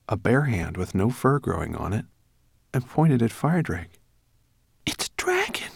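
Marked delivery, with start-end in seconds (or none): 4.8-5.7 awed whisper, voice gone. Acted. Boy whispering on seeing a real dragon. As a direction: breathe it out in wonder. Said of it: "he whispered".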